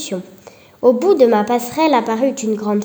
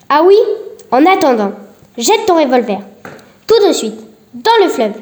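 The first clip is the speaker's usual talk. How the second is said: louder, higher, sarcastic then barking order; mocking command; 3 semitones higher, 5 dB louder than usual. Marie orders the old policeman to throw his revolver away, loud. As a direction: make him obey at once.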